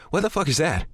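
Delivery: sudden high alarm. A narrator's reading. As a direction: blurt it out in shock. Alarm at horrifying sound.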